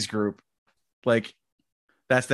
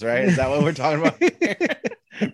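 ordinary talk

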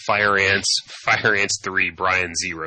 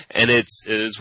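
usual speaking voice

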